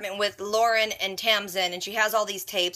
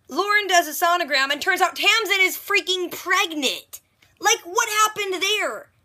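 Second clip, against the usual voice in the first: shocked disbelief; 8 semitones higher than usual. Real, not acted. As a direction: stretch it out in sheer disbelief. Shocked by a plot twist.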